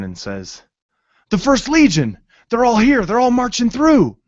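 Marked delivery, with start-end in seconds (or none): 1.3-4.2 much higher and louder, bursting with joy; excited shout. Acted. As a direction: burst into the tavern yelling the good news. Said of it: "excitement of happiness and joy".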